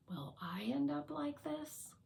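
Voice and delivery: whispered, uneasy wondering; hushed question. Acted. Resilience asks about ending up like Courage, hushed whisper. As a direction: whisper it as a private worry. Robot's fearful question to itself.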